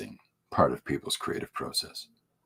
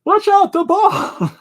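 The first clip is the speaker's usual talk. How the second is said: high, slow, loud yell; yelling a warning; 9 dB louder, slower than usual. Retold or imitated. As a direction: shout it across the outfield. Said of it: "we're constantly screaming at him".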